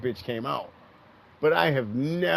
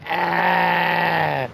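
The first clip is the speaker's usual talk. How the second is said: imitated cry; 7 semitones higher, 10 dB louder than usual. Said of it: "She cried".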